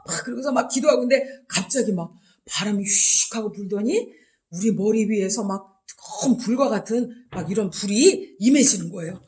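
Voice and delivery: ordinary delivery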